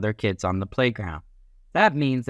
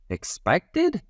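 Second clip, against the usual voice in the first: high questioning voice; 7 semitones higher than usual. Shown as a demonstration.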